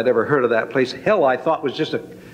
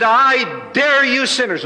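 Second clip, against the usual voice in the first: high, slow, drawn-out dare from the pulpit; preacher's challenge; 9 semitones higher, slower than usual. Retold or imitated. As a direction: hurl the dare at the sinners like a revival preacher. Preacher's altar call challenge.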